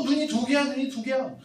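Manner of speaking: high-pitched repeated taunt; mocking chant of the monkey crowd; jeering mockery. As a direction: tease in a high voice. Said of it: "막 놀리는 거야".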